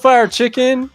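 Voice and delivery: usual speaking voice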